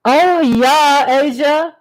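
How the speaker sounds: loud, high burst of remembering; excited recall. Real, not acted. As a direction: burst in with news for a friend. Suddenly remembers news for a viewer.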